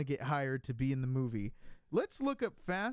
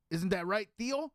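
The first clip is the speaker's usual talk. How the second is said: polished voice with a rising Theo; mock cultured Mexican son; lightly voiced refined accent; refined Chicano accent; 4 semitones higher than usual. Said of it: "So I'd talk like this".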